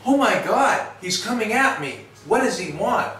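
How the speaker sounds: mock panic of a nervous shelter dog; frightened dog voice. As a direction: voice the dog's alarm, high and rushed. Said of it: "she's going like".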